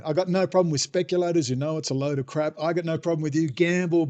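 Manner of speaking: ordinary talk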